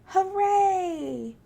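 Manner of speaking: high, loud cheer. A narrator's reading. Tigger celebrating getting his bounces back, loud cheer. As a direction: shout for joy.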